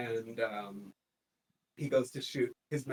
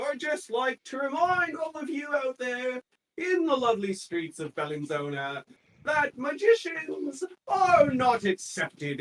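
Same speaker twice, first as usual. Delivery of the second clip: high, loud proclamation, slowing then shouted; a comedian warning the audience about magic, proclaimed; public announcement; 9 semitones higher, 5 dB louder than usual. Acted. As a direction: announce the decree to the crowd. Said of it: "Gonna do a public service announcement".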